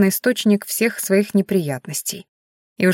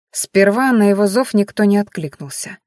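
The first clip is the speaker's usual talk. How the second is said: usual speaking voice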